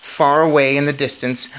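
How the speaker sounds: plain reading voice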